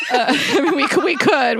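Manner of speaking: high laugh through tears; teary laugh. Real, not acted. Laughing through tears at reading the card. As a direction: laugh it off while welling up.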